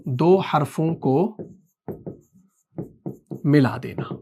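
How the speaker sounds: word-by-word for note-taking; slow dictation. Shown as a demonstration. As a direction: dictate with a long pause mid-phrase. Dictating the definition for notes.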